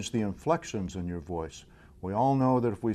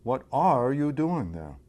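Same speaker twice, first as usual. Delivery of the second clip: "are" stretched and pushed louder; stress on ARE; 2 semitones higher, 4 dB louder, slower than usual. Shown as a demonstration. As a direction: lean hard and slowly on "are". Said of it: "demonstrate five different meanings".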